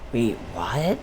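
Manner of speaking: very soft, low retold question; barely voiced puzzled question; hushed disbelief. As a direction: quietly act out being taken aback. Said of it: "We gotta be quiet".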